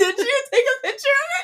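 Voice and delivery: high, loud shocked question; real astonishment. Real, not acted. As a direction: blurt it out, voice leaping way up. Learns of embarrassing photo just now.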